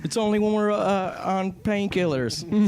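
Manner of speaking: high, slow dopey drawl; hammed-up loopy voice; mock loopy Southerner. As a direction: play a drugged Southern kid. Joking as a Southerner.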